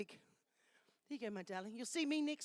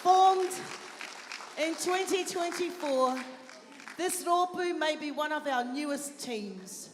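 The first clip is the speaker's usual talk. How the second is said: loud, proud announcer read; event MC read; 7 dB louder than usual. Acted. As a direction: present the group with pride. MC reads the group's introduction.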